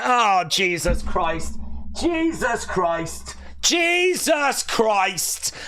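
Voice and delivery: slow, rising to a high shout; building from stunned to yelling Jesus Christ; shocked disbelief. Real, not acted. Reacting live as Sami hits Cody, shocked shout.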